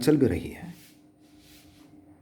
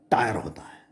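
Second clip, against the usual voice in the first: whispered punchline; 3 semitones lower, 4 dB quieter than usual. Shown as a demonstration. Reader whispers the tyre punchline to listeners, hushed. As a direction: drop to a hushed whisper on tyre होता है.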